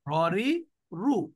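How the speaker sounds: ররি রু said very slowly; slow model repeat. Shown as a demonstration. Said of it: "আর একটা কি?". (ধীরে ররি রু)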